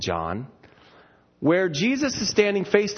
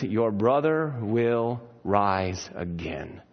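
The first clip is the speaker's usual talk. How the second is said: gentle Jesus; 7 semitones lower, much slower than usual. Retold or imitated. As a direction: lower and slow, tender. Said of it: "And he says".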